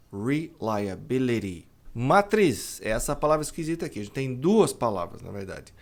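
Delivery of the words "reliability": reliability said slowly with tapped T; slow model word. Shown as a demonstration. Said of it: "o T ladeado por som de vogal". (reliability bem devagar, T como R)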